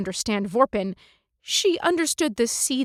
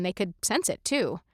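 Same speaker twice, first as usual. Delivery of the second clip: ordinary delivery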